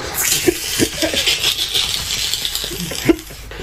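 breathless, high-pitched cackle; long wheeze of helpless laughter; high wheezing laugh. Real, not acted. Cracking up over his story.

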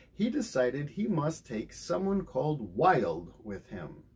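plain reading voice

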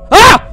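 high sharp yelp of fright; startled scream. Real, not acted. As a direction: shriek as something jumps out. Jump scare in horror game.

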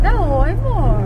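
high sing-song coaxing. Real, not acted. Coaxing child to say hi. (voz de falar com bebê)

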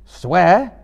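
loud, high, rising challenge; challenging. A narrator's reading. Frodo questions Gollum's offer to swear, challenging. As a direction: throw the word back forcefully.

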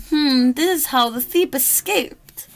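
usual speaking voice

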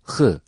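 Hı rasped and thick from the top of the throat; Arabic kha demo; rasping throat H. Shown as a demonstration. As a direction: scrape out Hı with a throaty rasp. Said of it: "boğaz biraz açılarak ve hırıldatarak çıkarılır".

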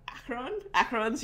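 Acheron tried as AK-er-on, questioning; trying the "k" Acheron. Shown as a demonstration. Host tries the friend's way, Acheron with hard "k". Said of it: "just doing it the other way".

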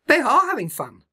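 high, bright cheerful bird voice; a friendly bird cheering him on; bird Iris. Acted.

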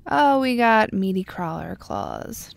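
ordinary delivery

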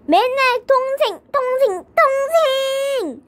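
high-pitched, mounting complaint; exasperated. Acted. Brother sick of hearing about his sister, exasperated. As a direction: stretch the last word in frustration.

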